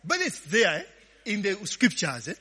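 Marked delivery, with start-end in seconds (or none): none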